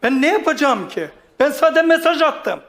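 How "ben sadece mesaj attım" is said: ordinary delivery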